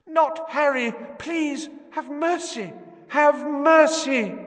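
high, loud pleading scream; desperate screaming. A narrator's reading. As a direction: beg for her child's life. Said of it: "The woman was screaming".

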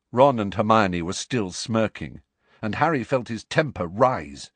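ordinary delivery

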